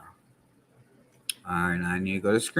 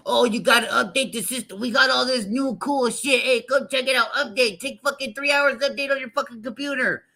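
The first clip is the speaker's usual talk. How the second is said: high, hammed-up voice of a smug tech company; high mock corporate pitch, faster at the end; mocking Microsoft; 14 semitones higher than usual. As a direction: sneer it out as a smug salesman pushing updates. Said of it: "they fucking".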